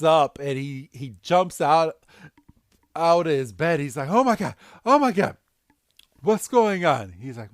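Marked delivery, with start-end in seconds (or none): 4.1-7.1 panicked. Retold or imitated. Go waking in panic.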